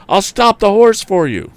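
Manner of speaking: high, loud promise; shouting. A narrator's reading. Tom shouting to the woman while racing the horse, high. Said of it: "he shouted".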